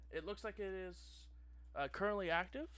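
polished phone rep voice; customer service voice. Acted. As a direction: slick help line operator. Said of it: "familiar customer service voice".

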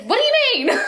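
high laughing disbelief. Retold or imitated. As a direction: squeal it out through a laugh. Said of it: "and then I was like".

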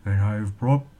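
a lightly voiced cheerful troll; happy troll voice. A narrator's reading. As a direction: speak up as a shy glad troll. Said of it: "in a happy, low-toned voice".

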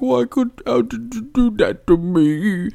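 high, whimpering mock lament; put-on high voice, dragging to a slow finish; mock-wounded Drake Maverick. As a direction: wail it like a betrayed friend. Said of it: "And Drake will be like".

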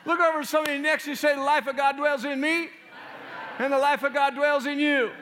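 loud, high, proclaiming declaration; preaching at full force. Acted. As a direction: proclaim victory over the whole church.

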